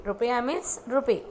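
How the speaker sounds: ordinary delivery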